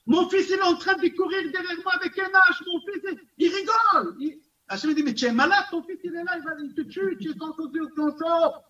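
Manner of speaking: high excited storytelling voice. Retold or imitated. Rabbi playing God answering King David, high and animated. Retelling David's psalm and God's reply.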